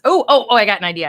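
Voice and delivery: sudden loud burst of realization; excited oh oh oh. Real, not acted. Signing cards, she suddenly gets an idea, excited.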